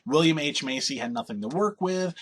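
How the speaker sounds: ordinary talk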